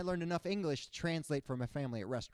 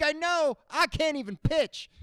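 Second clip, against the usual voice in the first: high and louder whine; hammed-up high whine; mock self-pity; 12 semitones higher, 8 dB louder, slower than usual. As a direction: wail it like a joke confession. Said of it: "I'm like".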